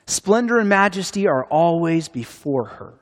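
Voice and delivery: ordinary talk, nothing marked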